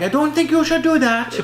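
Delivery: very high Irish-tinged mimicry; attempted Irish accent. Sister objecting to the kids, high attempted Irish accent. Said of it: "Irish accent and, you know, kinda".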